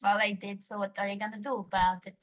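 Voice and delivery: normal talking voice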